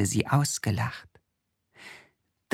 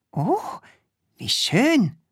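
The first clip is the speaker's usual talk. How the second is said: delighted Krümel; 11 semitones higher than usual. Acted.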